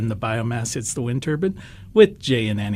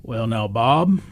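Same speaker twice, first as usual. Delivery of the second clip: put-on lightly done, lower Southern drawl of an older man; a hint of a Southern gentleman, slow and measured; deep Southern voice; 4 semitones lower, slower than usual. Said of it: "in a real deep Southern voice".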